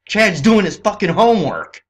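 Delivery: high, loud yell; exasperated shout; angry shout. Real, not acted. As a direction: blast it out at full volume. Peak of sarcastic rant.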